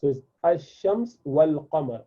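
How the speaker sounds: usual speaking voice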